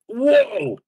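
high, swooping exclamation; startled whoa. Real, not acted. Player surprised by speed in water, startled cry. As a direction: let out a sudden high whoa.

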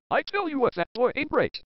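lightly voiced cartoon dad impression; Homer Simpson. Showing friends a Homer Simpson impression, lightly put-on voice. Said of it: "here's my Homer Simpson impression".

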